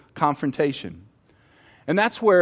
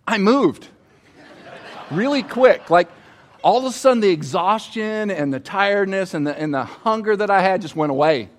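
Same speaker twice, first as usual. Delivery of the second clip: high and loud, reliving the fright; laughing, breathless alarm at the snake; excited retelling; 6 semitones higher, 4 dB louder than usual. Retold or imitated. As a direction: jump up in pitch as if fleeing the snake again. Reliving fleeing from a rattlesnake.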